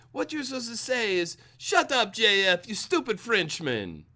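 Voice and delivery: high-pitched mock foreign accent, vaguely French; hammed-up, muddled European accent; one-size-fits-all foreign accent. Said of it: "I only have one foreign accent".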